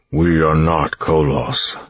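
slow, strained monster voice; words forced through stretched lips; Koloss voice. A narrator's reading. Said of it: "through lips that were stretched too tightly".

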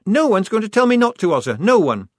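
high, loud defiant outburst; rounding on her. A narrator's reading. Shufti rounding on Polly who suggests going home, defiant. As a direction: turn on her and refuse to back down. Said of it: "rounding on her".

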